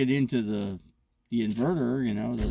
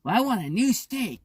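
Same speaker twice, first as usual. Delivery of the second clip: high put-on voice of a demanding lady; mimicking her demand for a new steak; mock demanding woman; 10 semitones higher than usual. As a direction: pitch it way up, spoiled and insistent.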